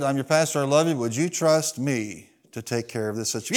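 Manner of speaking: soft, low-key retold pastor; quiet, unruffled pastoral question; very calm. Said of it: "he just looked at him very calmly and he said".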